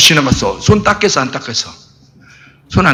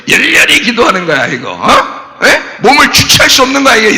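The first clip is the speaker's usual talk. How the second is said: usual speaking voice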